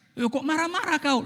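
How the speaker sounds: indignant retort. Acted. Husband snapping back at his nagging wife, high and indignant. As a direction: throw the blame back at her.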